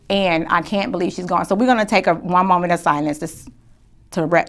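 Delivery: host honoring a friend who passed, softly grieving; grieving tribute. Real, not acted. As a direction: hold back tears gently. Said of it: "I'm gonna try not to cry".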